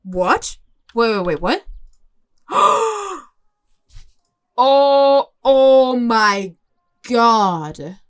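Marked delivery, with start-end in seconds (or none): 2.5-3.2 surprised gasp. Real, not acted. Discovers recording spot. 4.6-8.0 excited. Real, not acted. Discovers recording spot.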